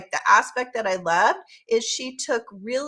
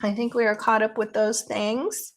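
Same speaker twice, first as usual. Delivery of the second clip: ordinary delivery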